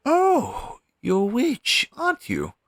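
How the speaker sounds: high, loud burst of surprise; surprised realisation. Acted. Crow demon realising the stranger is a witch, surprised. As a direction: exclaim it, pitch leaping right up.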